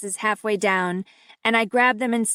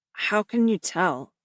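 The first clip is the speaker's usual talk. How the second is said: through clenched teeth. A narrator's reading. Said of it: "he asks through clenched teeth".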